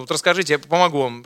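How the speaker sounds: ordinary delivery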